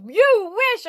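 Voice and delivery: high, loud disbelieving "You wish?"; shocked echo. Retold or imitated. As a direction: throw it back in shock, way up high. Nephew's shocked echo, high pitched.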